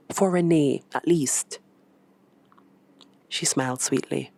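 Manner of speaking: usual speaking voice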